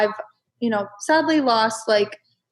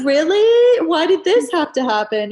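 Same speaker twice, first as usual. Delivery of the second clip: exasperated outcry; 8 semitones higher, 8 dB louder than usual. Retold or imitated. Exasperated quote, laughing.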